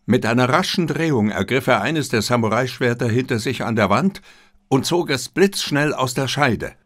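ordinary delivery

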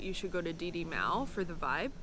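ordinary talk, nothing marked

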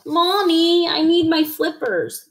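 high, whiny call to mommy; pleading child. A narrator's reading. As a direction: wheedle like a small child. Child froggy pleads to mother.

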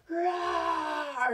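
high, long mock roar; hammed-up beast roar; imitated gorilla roar. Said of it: "and he roars".

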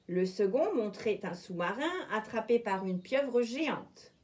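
ordinary delivery

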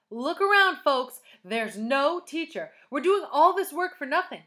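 loud, high-pitched proclamation; rallying shout. A narrator's reading. Myron atop his desk to classmates, loud rallying call. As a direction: address the whole room, rousing them.

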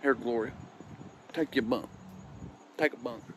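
low, soft imitated uncle; hushed uncle at casket. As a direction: keep it down, low and furtive. Uncle's hushed words beside the casket.

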